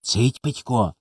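softly spoken hush. A narrator's reading. Коля bends to his brother's ear to scare him, hushing. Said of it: "Схилився до самого вуха".

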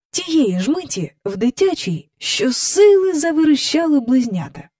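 usual speaking voice

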